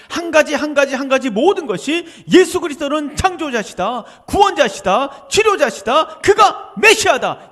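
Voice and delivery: loud, slow, climbing proclamation of each title; preaching at full force. Acted. Preacher proclaiming Jesus's titles to the congregation, slow and rising.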